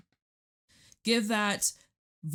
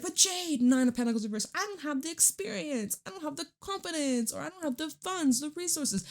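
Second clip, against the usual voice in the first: whiny doubting viewer; 8 semitones higher than usual. Retold or imitated. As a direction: play the doubter listing excuses. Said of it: "But you're like".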